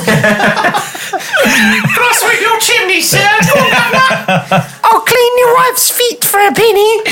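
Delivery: high, laughing Cockney chimney sweep; squeaky Victorian Cockney street sweep; old london cockney. Acted. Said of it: "that's not what chimney sweeps say".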